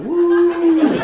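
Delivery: high, loud stag-do whoop; mock party whoop. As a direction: whoop like a drunk groom egging on a shark. Groom dressed as seal taunting.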